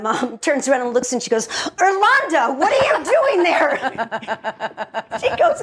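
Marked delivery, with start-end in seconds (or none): 1.9-3.7 much higher, loud mock surprise; hammed-up high-pitched astonishment, laughing at the end; astonished exclamation. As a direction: exclaim as if suddenly spotting someone. Mom startled to spot Irlanda.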